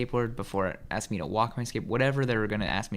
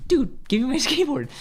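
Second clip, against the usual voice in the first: high, louder plea, half laughing; comic exasperated demand; indignant protest; 6 dB louder than usual. Retold or imitated. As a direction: imitate your younger self objecting. Acted protest to angry man.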